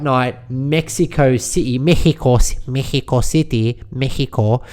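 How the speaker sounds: Mexico said Spanish style as /mɛhɪko/ with a breathy h. Pretend. Podcaster savouring Mexico the Spanish way, slowly.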